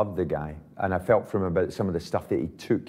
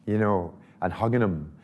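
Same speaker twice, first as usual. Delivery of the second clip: usual speaking voice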